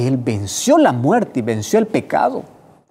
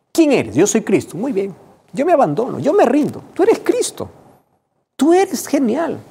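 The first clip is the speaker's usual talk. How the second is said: much higher, louder, ecstatic surrender; entrega exaltada; 11 semitones higher, 4 dB louder than usual. Retold or imitated. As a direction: cry out your surrender in awe. Saulo se rinde ante Cristo. (Saulo rendido ante Cristo, exaltado)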